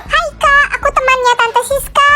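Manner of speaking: put-on childish voice. Child voice high.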